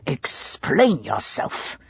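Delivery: low, slow, tight with fury; ground out between teeth. A narrator's reading. A furious Pitt confronts Emily in the house. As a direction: grind it out through clenched teeth. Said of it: "he ground out between his teeth".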